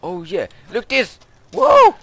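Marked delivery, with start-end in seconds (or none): 1.5-1.9 high, loud startled whoa; startled yell. Real, not acted. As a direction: jump at something sudden. Startled in game.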